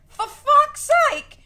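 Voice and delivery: high, loud, drawn-out shout with a laugh; laughing yell. Shown as a demonstration. To viewers, redoing her curse as a laughing yell. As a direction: belt it out, half laughing. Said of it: "Is this the first time I say "for fuck's sake"?".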